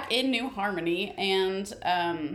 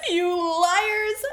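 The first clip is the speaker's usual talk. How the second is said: mock wail; 9 semitones higher, 4 dB louder than usual. Grandpa voiced, betrayed.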